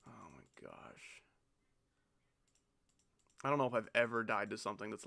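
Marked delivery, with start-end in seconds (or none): 0.1-1.2 much lower, very soft despair; drained player groaning at the screen; exhausted groan. Real, not acted. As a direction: barely get it out, drained. Defeated after another death.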